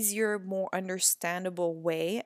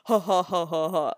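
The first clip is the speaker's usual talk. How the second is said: loud, deliberately forced laugh; fake laugh; 3 semitones lower, 6 dB louder than usual. Mother and daughter making up with loud fake laughter. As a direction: ham up an obviously fake laugh. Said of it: "Like, all fake".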